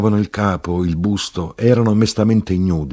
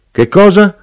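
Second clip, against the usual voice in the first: questioning; 9 dB louder than usual. A narrator's reading. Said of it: "diceva l'arrotino".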